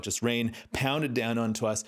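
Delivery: ordinary talk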